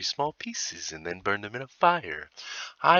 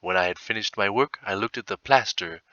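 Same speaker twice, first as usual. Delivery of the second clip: usual speaking voice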